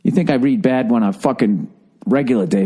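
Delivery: ordinary talk, nothing marked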